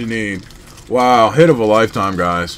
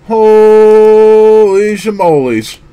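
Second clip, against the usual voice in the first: Gary Drayton catchphrase; 11 semitones higher, 10 dB louder, much slower than usual. Retold or imitated. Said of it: "As my buddy Gary Drayton would say".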